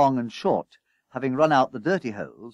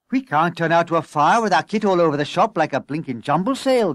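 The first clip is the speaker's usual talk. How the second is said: loud, higher-pitched objection; mildly aghast, raised voice; gasped protest; 5 semitones higher, 6 dB louder than usual. A narrator's reading. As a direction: protest in dismay. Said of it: "gasped Shorty".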